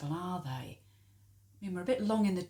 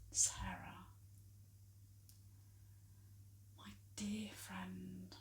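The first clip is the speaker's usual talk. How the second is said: soft, slow and tender, with long pauses; hushed, lingering affection; 13 dB quieter, slower than usual. Acted. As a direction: address a dear friend gently, almost under the breath. Tender address to unconscious friend.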